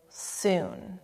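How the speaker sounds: a soft, hushed single word; gentlest note. Acted. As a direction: breathe it gently to a dying soul. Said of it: "the gentlest of the three".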